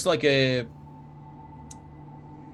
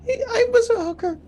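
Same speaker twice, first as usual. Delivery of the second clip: tiny scared plant voice. Acted. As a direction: whimper it like a tiny trapped kid.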